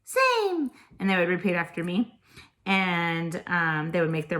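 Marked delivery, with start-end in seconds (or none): 0.1-0.7 very high, squeaky little puppet monster; high-pitched monster voice. Shown as a demonstration. Teacher voicing a finger-puppet monster for Latin, high-pitched. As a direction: pipe up like Elmo, words drawn out. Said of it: "do it in a high-pitched voice".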